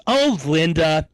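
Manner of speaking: slow, unmoved brush-off; cold dismissal. Retold or imitated. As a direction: shrug it off slowly, no comfort. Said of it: "in a not very warm or comforting way".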